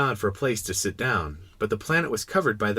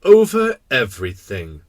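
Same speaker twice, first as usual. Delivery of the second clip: king, simply stated; 9 dB louder than usual. Acted. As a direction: state it with simple regal authority. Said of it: "the king answered, with great simplicity".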